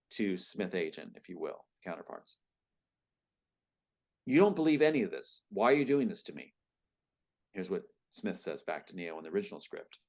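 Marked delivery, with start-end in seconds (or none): 4.3-6.6 higher, louder protest from the cornered hero. Acted. Hero challenges Smith who doubts all this, protesting. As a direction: push the accusation out, then ease into the question. Reads out hero's protest to agent.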